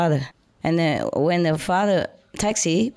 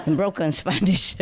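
chuckling through the words; giggling at her own clumsy Spanish; real laughter mid-sentence; laughing; 3 semitones higher than usual. Real, not acted.

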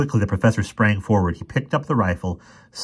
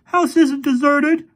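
very high, loud, drawn-out retort; snapping; 7 dB louder, slower than usual. A narrator's reading. Boy with rifle contradicts the professor, snapping. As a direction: snap back as a mean teenage boy. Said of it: "snapped the boy".

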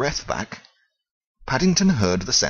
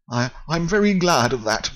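polite bear; 6 semitones higher than usual. Acted. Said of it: "politely raising his hat".

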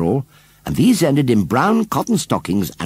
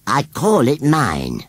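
slow, higher scarecrow voice; 5 semitones higher, 4 dB louder, much slower than usual. A narrator's reading. Scarecrow claims the baby as his, slowly. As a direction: draw each word right out. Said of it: "said Gummidge slowly".

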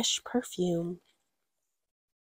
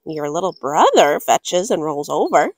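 lower, dry parent voice; lightly voiced parents; 6 semitones lower, 3 dB louder than usual. Parents teasing about the brother, dry low reply. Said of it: "said her parents".

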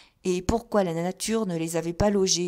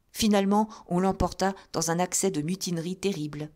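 plain reading voice

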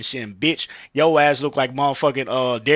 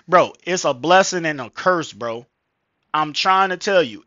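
ordinary talk

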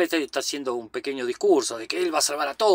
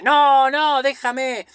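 much higher, louder panicked begging; desperate plea; 10 semitones higher, 7 dB louder, much slower than usual. Retold or imitated. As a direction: cry out no, no as if about to be shot. Captive begs the sergeant.

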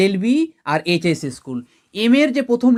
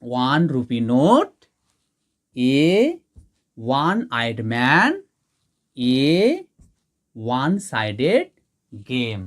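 slow, low, spaced words for students to write; slow dictation; 7 semitones lower, slower than usual. Shown as a demonstration. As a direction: dictate each phrase slowly with pauses. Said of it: "তাহলে আমরা এ বসিয়ে দিই". (ধীরে ধীরে থেমে থেমে বলা, যেন ছাত্ররা লিখছে)

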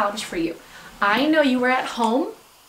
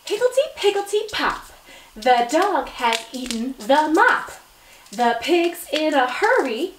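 higher, lively rhythmic poem reading; best reading voice; 5 semitones higher, 3 dB louder than usual. Shown as a demonstration. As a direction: read the rhyme with bounce for kids. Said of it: "In your best reading voice".

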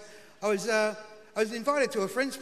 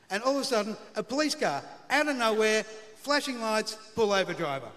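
normal talking voice

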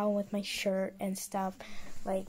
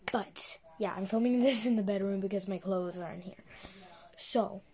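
normal talking voice

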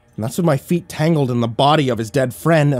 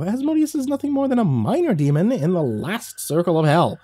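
scoffing squirrel; 6 semitones higher than usual. Acted.

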